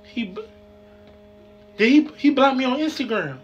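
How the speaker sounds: high, panicked and scared; panicking, voice climbing. Acted. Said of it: "I'm scared".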